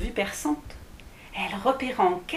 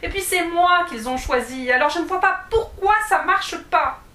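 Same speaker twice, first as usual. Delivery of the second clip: high, louder whiny protest; upset little girl; 8 semitones higher, 4 dB louder than usual. Acted. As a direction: complain like a sulking child. Alice protesting.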